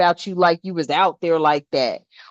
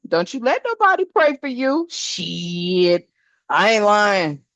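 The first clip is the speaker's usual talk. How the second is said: usual speaking voice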